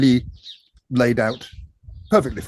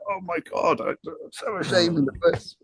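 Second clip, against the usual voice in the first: higher, softer mock anguish; mock shame; 6 semitones higher, 4 dB quieter than usual. Recalling shame over his remix, higher mock anguish. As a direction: wail in hammed-up embarrassment. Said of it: "all my life I've been like".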